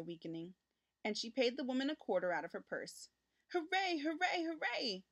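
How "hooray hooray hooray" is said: put-on higher excited boy's cheer. A narrator's reading. Richard cheers as mother buys the kitten, high and excited. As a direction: cheer and bounce with joy. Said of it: "cried Richard".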